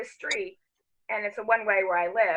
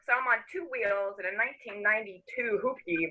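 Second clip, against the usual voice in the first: usual speaking voice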